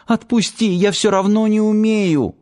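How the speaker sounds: high-pitched tearful appeal; tearful pleading. A narrator's reading. Crying girl begging attacker to let her go, pleading. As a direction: beg to be released, pitch held high. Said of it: "взмолилась плачущая девушка".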